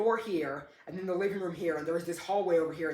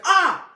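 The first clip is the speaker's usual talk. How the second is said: short, sharp, very loud high yelp; demonstrated scream; 11 semitones higher, 13 dB louder than usual. Shown as a demonstration. Showing what the brother didn't do, demonstrated short scream. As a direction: burst out in a quick startled scream. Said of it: "he didn't scream. It wasn't like".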